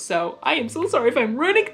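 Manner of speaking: ordinary delivery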